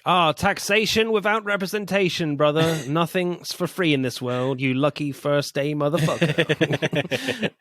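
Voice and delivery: high, mocking swagger; hammed-up villain sneer; mock gangster. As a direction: taunt the new guy with a grin. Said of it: "that was good".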